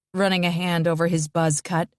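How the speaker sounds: plain reading voice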